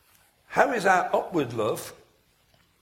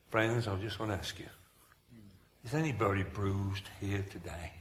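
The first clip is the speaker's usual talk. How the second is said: hushed, low rally appeal with long pauses; soft altar call; 4 semitones lower, 6 dB quieter than usual. Acted. Preacher mimicking a rally altar call, softly pleading. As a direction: coax the hurting forward, softly.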